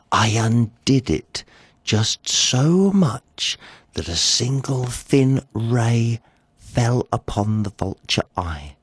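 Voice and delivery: plain reading voice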